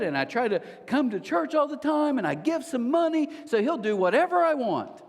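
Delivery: high, mock-innocent believer; singsong up high, parodying naive expectations; hammed-up self-satisfied logic, pitched high; naive entitlement.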